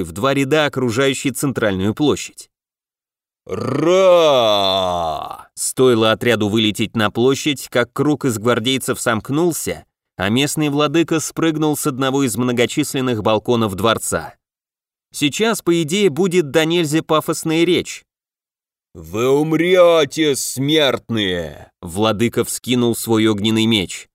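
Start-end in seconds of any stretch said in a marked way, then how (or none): 3.5-4.8 short fierce roar; battle roar. Acted. 18.9-21.7 slow menacing villain proclamation; drawn-out grand threat; pompous demon lord. Acted.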